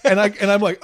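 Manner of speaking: normal talking voice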